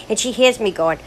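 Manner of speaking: usual speaking voice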